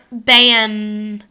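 "Ban." ending on a front N. Shown as a demonstration. Teacher modeling ban before bank, front N. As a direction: close "Ban." with the tongue tip. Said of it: "Your tongue comes to the front".

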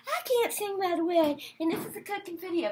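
sneering impression of Matt; mocking Matt. Vlogger mocks Matt's voice from hell, sneering. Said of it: "who's got a voice from hell".

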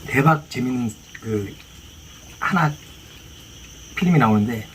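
ordinary talk, nothing marked

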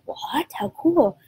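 imitating parents caught off guard; amazed. Parents react with amazement, quoted.

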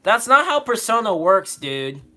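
half-laughing indignant yell; indignant outburst. Real, not acted. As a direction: blurt it out in disbelief.

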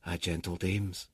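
ordinary delivery, nothing marked

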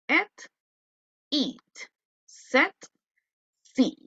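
teacher comparing vowel pairs for the class; E versus I pairs. Shown as a demonstration. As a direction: contrast E and I word by word. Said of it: "la teacher termina de pronunciar nuevamente".

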